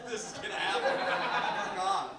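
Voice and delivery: laughing it out loud. Real, not acted. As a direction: burst out laughing. Laughing outburst at own joke.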